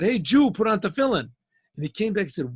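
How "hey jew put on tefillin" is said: hey, hail him louder. Retold or imitated. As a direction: call out loudly across the market. Calling out to man.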